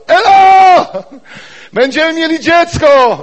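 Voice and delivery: very high, loud joyful shout; shouting from afar. Retold or imitated. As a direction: call to your wife from far off. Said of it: "by już z daleka krzyczał". (radosny krzyk męża z daleka do żony)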